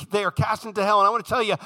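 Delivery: ordinary talk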